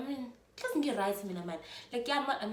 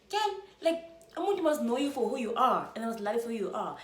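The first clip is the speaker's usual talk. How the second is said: higher, louder motherly advice; mom's voice; 4 semitones higher, 6 dB louder than usual. Retold or imitated. Mom lovingly lectures her daughter about being loved, higher and louder.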